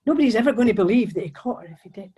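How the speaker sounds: plain reading voice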